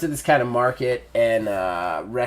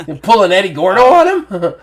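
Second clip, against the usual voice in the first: loud laughing question; 8 semitones higher, 10 dB louder than usual. Real, not acted. GM laughs at the Tekken move idea, loud and giggling. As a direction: blurt it out through laughter.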